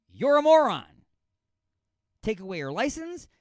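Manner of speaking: very loud, high angry yell; top-volume insult; furious shout. Real, not acted. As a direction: let her have it at full volume. Insulting the mother.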